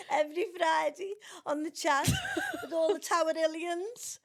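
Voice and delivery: laughing through the words; real laughter breaking in. Real, not acted. Laughing at own story.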